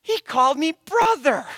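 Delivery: imitating a thrilled little boy; excited child. The boy calls out, a lightly voiced excited kid. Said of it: "he yells out".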